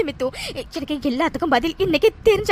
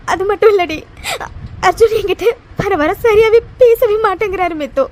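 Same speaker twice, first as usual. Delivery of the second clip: sobbing words out to a friend; crying to her friend about Arjun; 4 semitones higher, 7 dB louder than usual. Acted. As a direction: let the tears break each phrase. Holds crying throughout.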